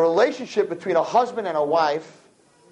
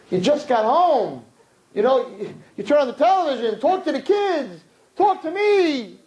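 complaining wife; 5 semitones higher than usual. Acted. Wife begging newly arrived husband for attention, whiny pleading. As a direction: plead for attention, climbing higher.

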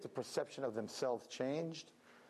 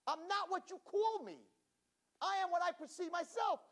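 high, proud declaration; defiant spies. Acted. Spies telling the giants, high proud defiance. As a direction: stand tall and declare it.

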